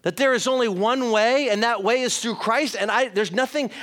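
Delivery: ordinary talk, nothing marked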